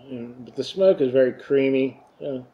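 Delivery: speaking around pipe stem; talking with pipe in mouth. Real, not acted. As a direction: lips clenched on pipe. Seen 2: Pipe stem held between lips throughout the labelled words.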